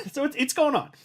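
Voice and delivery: ordinary talk, nothing marked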